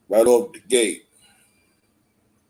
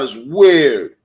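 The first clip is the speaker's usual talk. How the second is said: usual speaking voice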